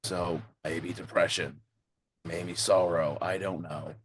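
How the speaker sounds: low, pensive character voice; low-voiced game character. Player guessing at the mystery in character, low and pensive. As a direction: speak low, pausing between guesses. In-character speculation, lower than own chat.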